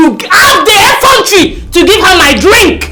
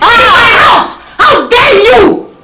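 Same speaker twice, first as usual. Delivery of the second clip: outraged shout; 7 semitones higher than usual. Acted. Sister screaming at the wife in her house. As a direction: scream it at the intruder.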